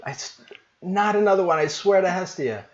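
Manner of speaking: a mother at her wits' end; exasperated mom. Acted. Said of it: "she's like".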